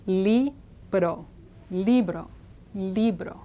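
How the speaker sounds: "libro" as lee-bro with a quick tapped r, falling; Spanish tapped r. Shown as a demonstration. Teacher models the correct word for learners, tapped r. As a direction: model "libro" carefully, the r a light flick of the tongue. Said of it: "It's just".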